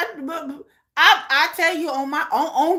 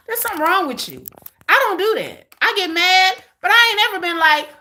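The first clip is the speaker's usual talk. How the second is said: ordinary delivery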